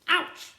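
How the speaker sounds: very high loud yelp; ouch yelp. Acted. Twin yelping after a whack, yelp.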